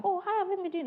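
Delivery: high, soft rep asking after a customer; acted customer check-in. Acting rep asking customer for feedback.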